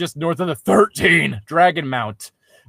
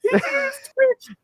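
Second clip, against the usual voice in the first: high mock-quoting of the line; mocking repeat. Friend mocking the story's repeated line, high sneer. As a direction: parrot it back in a high sneer.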